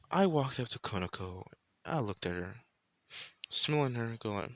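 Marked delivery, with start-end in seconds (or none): none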